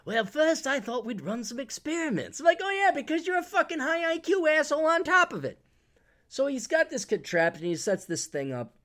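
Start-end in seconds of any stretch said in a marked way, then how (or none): 0.0-2.4 higher, prissy British presenter; hammed-up British lecturer; mock British accent. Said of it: "guy with a British accent". 2.6-5.6 very high, strained comic rant; high-pitched sarcastic indignation; mock exasperated outburst. Mock retort to the clever presenter.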